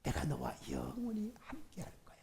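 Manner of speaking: tender, hushed whisper of God's promise; soft whisper. Shown as a demonstration. Pastor voicing God's comfort to a congregation, whispered.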